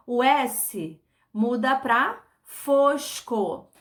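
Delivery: slow, careful classroom explanation; slow demonstration. Shown as a demonstration. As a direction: draw out fosco. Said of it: "teacher shows the new word".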